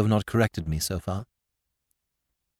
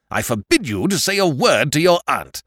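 a sharp, forbidding cry; 6 semitones higher, 4 dB louder than usual. A narrator's reading. Sir Thomas crying out to stop Spencer. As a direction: stop the nephew dead. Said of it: "he cried".

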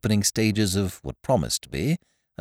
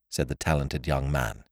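plain reading voice

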